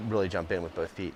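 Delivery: usual speaking voice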